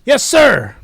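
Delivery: loud, high "yes, sir"; mock soldier snapping to it; barked acknowledgement. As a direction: answer the commander at full voice. Acted subordinate answering an order.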